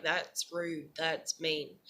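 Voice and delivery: low, soft, wounded objection; quietly hurt. Retold or imitated. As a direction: murmur it low, imitating your hurt self. Retelling quietly hurt reaction to memes.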